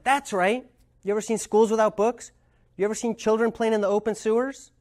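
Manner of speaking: high, challenging questions; heated venting. Retold or imitated. Angry man challenging Marshall about children's conditions. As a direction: throw each question back at him. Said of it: "The guy's like".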